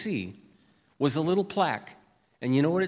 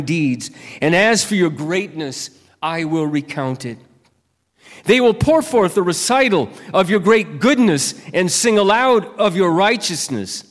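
slow, louder proclaiming psalm read; scripture reading; 3 semitones higher, 9 dB louder than usual. Acted. Pastor reading Psalm 145 aloud to the church, slow and proclaiming. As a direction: lift each line up as praise.

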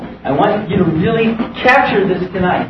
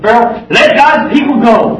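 demanding; 5 semitones higher, 6 dB louder than usual. Retold or imitated. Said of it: "Moses went to Pharaoh and said".

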